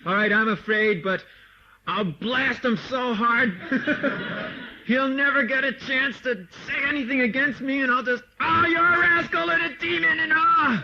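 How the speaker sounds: a scared preacher hamming up courage, laughing through it; mock bravado. Teacher mocking a frightened devotee's bluster, hammed-up bravado. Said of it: "you're all so afraid".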